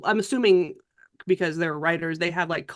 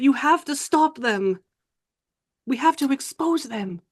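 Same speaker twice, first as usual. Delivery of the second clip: put-on higher French-accented lady, halting then urgent; earnest, fearful Frenchwoman; 5 semitones higher than usual. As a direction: confide a dark warning, then plead. Plays a fearful French woman.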